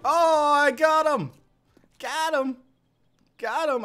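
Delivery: triumphant shouts. Real, not acted. Finally kills the bear.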